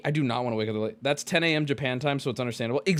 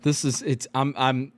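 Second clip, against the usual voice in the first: ordinary talk, nothing marked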